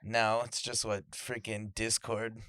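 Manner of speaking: ordinary delivery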